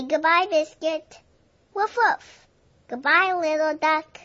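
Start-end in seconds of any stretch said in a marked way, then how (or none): 1.7-2.5 two very high barks; high puppy woofs; happy dog bark. Acted. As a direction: bark a cheerful goodbye. Dog barking in story.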